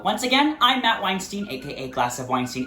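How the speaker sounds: bright, upbeat host outro; lively host wrapping up the show; show sign-off. Acted.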